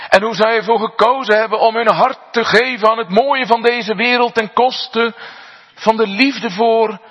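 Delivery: high, loud, fast, building to a cry on God; fiery preaching. Acted. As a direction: preach it hard, climbing as you go. Preaches at full force. (vurig preken, hoog en luid opbouwend)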